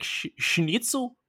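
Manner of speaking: put-on a half-hearted German accent on schnitzel; mock German accent. Co-host imitating Lord Alfred Hayes, mock German accent. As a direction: toss off schnitzel in a quick, failed German accent. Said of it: "why the fuck does Lord Alfred Hayes sound German?".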